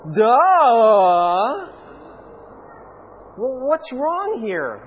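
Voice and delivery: put-on loud kid-style duh, then slow puzzled; mock God as an exasperated kid; God's duh. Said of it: "And He goes".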